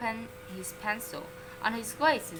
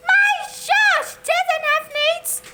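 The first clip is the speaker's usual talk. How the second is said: much higher, loud shriek of a mother; shout; 10 dB louder than usual. A narrator's reading. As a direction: yell it in high outrage. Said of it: "shouted his mother".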